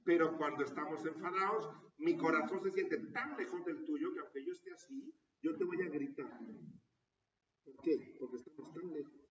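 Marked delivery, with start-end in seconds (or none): 0.0-6.4 angry shout. Shown as a demonstration. Shows how angry people shout.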